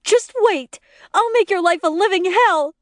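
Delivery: vengeful threat. A narrator's reading. Glaring wife threatens husband before leaving.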